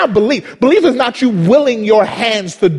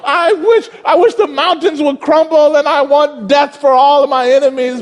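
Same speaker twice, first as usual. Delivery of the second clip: David going off; 7 semitones higher than usual. Retold or imitated. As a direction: ham up David's rage at God. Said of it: "he would go off".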